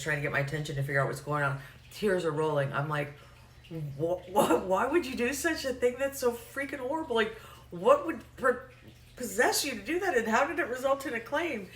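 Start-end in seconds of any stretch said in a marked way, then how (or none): 3.7-11.7 high-pitched, incredulous questioning; aghast disbelief. Retold or imitated. Horrified agent questioning the caller, high incredulous voice. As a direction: react in mock horror, pitched way up.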